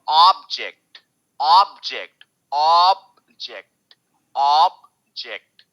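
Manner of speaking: Object, ऑब drawn long. Shown as a demonstration. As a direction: pull out the ऑब in object. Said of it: "ऑब खींच रहे हैं".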